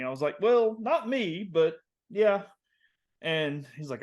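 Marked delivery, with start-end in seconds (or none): 0.4-2.4 high, slow, reluctant concession; retold sheepish hedging, stretched out; awkward admission. Son admitting the trouble to dad, awkward and hedging.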